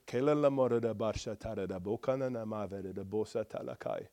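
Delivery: soft, low, run-on prayer language; long tongues sentence. Shown as a demonstration. Preacher showing longer sentences in tongues, soft flowing prayer. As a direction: pray one long flowing phrase, low and quiet. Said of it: "Some sentences are longer".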